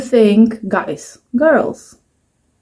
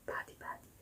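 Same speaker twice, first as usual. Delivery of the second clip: whispered mock; 32 dB quieter, faster than usual.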